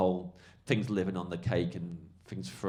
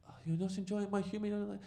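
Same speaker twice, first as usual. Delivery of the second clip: much higher, put-on querying voice; mock-innocent comic; 12 semitones higher, 4 dB quieter than usual. Comic answering an angry heckler, high mock-innocent voice. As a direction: imitate a comic innocently needling in a high pitch.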